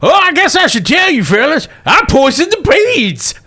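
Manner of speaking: put-on high old prospector voice; casual old-timer confessing. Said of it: "And Russell says".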